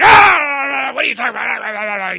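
loud angry bark, high and loud; barking shout. Retold or imitated. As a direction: bark it like a furious host. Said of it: "He barks at me".

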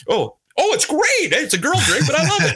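high-pitched gushing over a drink; hammed-up surprised enjoyment; mock delight. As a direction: gush in a put-on high voice. Acting out loving a girl drink.

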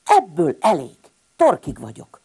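loud fed-up shout; bursting out, sick of it all. Acted. Little hedgehog fed up with his siblings, loud shout. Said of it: "kiáltott fel Sün Balázs".

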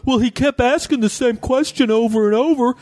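high, put-on voice of a clueless heckler; mock dopey voice. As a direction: play the excuse like a dim guy. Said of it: "And he goes".

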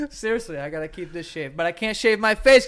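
ordinary talk

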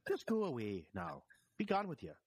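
lilting Irish leprechaun voice; a touch of a shooing Irish leprechaun. Pretend. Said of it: "the weird leprechaun voice that I seem to be doing".

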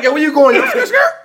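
high, laughing mock ad-lib ending; imitated rapper. Co-host mimicking how the rapper talks, laughing impression. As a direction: answer like a rapper yelping car noises.

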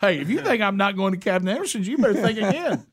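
high, laughing mock challenge; excited insistence. Husband to wife about the restaurant, high excited challenge. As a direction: squeal it out like a giddy kid.

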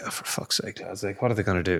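muttered, exasperated aside under the breath. Retold or imitated. Fed-up fan scoffing at pundits, exasperated under breath.

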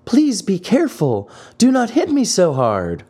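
tiny high pleading voice; beseeching wee voice. A narrator's reading. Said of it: "in a beseeching tone".

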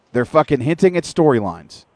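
usual speaking voice

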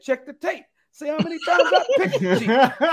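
high-pitched self-quote with a laugh; high mock voice. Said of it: "And I said".

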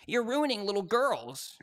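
hammed-up accusing critic; mock scolding. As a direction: imitate moral panic, slowly. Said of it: "They were like".

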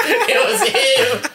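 slow, high, laughing reveal. Retold or imitated. Guessing who landed on the jumper, laughing. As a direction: draw it out through laughter. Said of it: "I was like".

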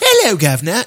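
a hint of upper-class British toff; half-hearted rich British guy accent; upper crust British. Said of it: "rich British guy, the upper crust".

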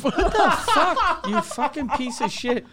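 drawn-out, high-pitched mock outrage; exasperated squeal at someone's useless post. Friend recounting his reaction to a bad tweet, mock outrage. Acting own outburst at tweet.

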